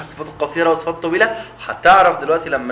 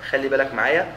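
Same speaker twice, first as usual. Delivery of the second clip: normal talking voice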